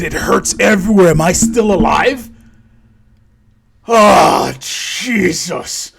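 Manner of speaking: loud, strained cry of agony; hammed-up anguished shouting; pained outburst. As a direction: wake up cursing in pain and disbelief. Character wakes in agony, alive.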